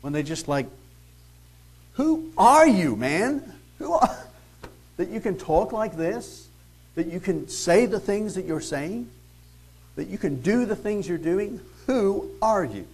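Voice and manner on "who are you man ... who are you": high, loud exasperated demand; frustrated outburst. Retold or imitated. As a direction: throw up your hands and demand who he is. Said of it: "it's almost in frustration".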